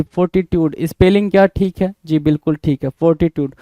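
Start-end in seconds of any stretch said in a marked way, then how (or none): none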